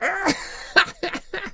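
very high giggling fit; drunken laugh. Acted. Tipsy Maruf lost in his nonsense, drunken laugh.

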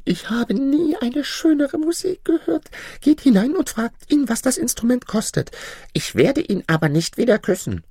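hohe, gezierte Prinzessinnenstimme; Prinzessin. Acted. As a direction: spiel die verwöhnte Prinzessin mit hoher Stimme. Princess voice. (hochgestellte, affektierte Prinzessin)

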